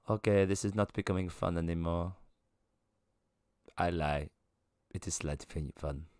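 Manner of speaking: soft, low deadpan figure; aloof non-human woman. Acted. She concedes the game is slightly fun, soft aloof deadpan.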